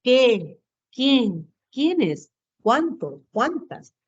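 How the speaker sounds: "Qué", "Quién", "Quiénes" each peaking and then falling; exclamatory tune. Shown as a demonstration. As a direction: exclaim each word from "Qué" to "Cuántas" separately. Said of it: "¿cuáles son esos pronombres exclamativos?".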